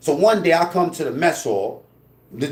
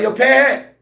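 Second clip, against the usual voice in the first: loud, high shout across the hall; calling out; 11 semitones higher, 6 dB louder than usual. Retold or imitated. Calling out to Pearhead across the mess hall, loud high shout. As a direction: yell his name to get him to turn.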